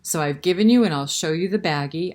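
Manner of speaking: usual speaking voice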